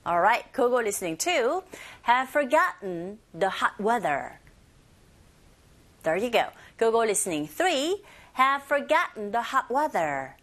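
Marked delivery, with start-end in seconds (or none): 2.0-4.4 slow, light-"have" model for repeating; slow teacher model; slow model reading. Shown as a demonstration. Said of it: "따라해보세요". 8.3-10.4 slow, light-"have" model for repeating; slow teacher model; slow model reading. Shown as a demonstration. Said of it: "따라해보세요".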